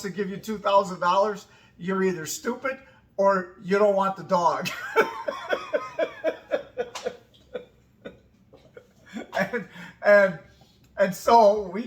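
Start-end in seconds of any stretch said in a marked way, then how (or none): 4.7-7.1 high, long cackle of a laugh; big laugh. Real, not acted. Laughing at her own joke about the kennel. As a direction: let a high laugh roll out.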